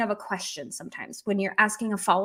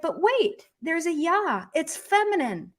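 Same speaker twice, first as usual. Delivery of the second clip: higher-pitched objecting beginner; puzzled new learner; 6 semitones higher than usual. Acted. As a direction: voice a confused student, pitched up. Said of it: "a new learner of Russian".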